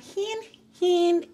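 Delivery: high, loud sing-song hint; teasing hint. Real, not acted. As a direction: drop the clue playfully. Teasing clue.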